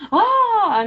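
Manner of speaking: a high, loud exclaimed "Oh!"; exclamation. Shown as a demonstration. Teacher showing students what an exclamation sounds like, sharply high and loud. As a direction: burst out "Oh!" with sudden surprise. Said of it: "quando é uma exclamação".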